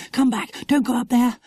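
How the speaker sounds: high, urgent plea; frightened child's warning, pitched well up; alarmed warning. Acted. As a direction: call them back from danger. Lucy Ann warns Jack back.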